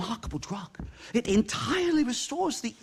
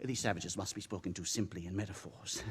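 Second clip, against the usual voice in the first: quiet aside; 10 dB quieter than usual. Acted. Snide aside to companion.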